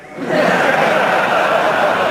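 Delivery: high airy burst of laughter; breathy laugh. Real, not acted. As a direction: laugh hard, almost silent. Laughing about spam campaign.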